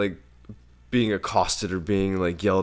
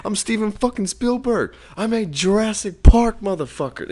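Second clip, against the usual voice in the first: laughing, higher, louder mock tirade; hammed-up Spielberg yelling at a beach mom; mock Spielberg rant; 13 semitones higher, 9 dB louder than usual. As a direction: yell it through laughter like an outraged director.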